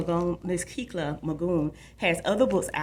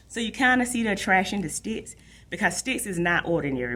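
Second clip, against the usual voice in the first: ordinary delivery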